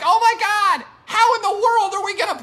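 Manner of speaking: high, loud mocking panic voice; Corona Bro voice. Host mocking panicked sports doomsayers, high Corona Bro voice. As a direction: squeal it like a panicking worrier. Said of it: "this is the Corona Bro voice".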